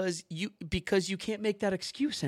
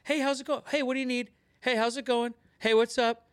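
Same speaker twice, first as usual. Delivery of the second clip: cheerful phone greeting; 6 semitones higher than usual. Shown as a demonstration. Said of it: "And just say".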